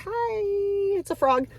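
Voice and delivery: high, bright hello to a frog; a playful demo greeting; chirpy greeting. Shown as a demonstration. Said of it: "If I said to you".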